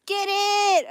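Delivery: high, stretched cheer; high-pitched "get it" call; urging on. Real, not acted. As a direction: egg the dog on in a high voice. Urging on the running dog.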